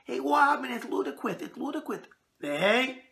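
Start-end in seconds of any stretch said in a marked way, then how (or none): 0.0-2.0 high lisping Mike Tyson voice; squeaky lisp, like Mike Tyson; Mike Tyson impression. Host imitating Tyson for his viewers, high squeaky lisp. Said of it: "Let me see if I can get his voice".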